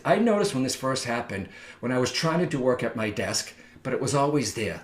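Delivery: ordinary delivery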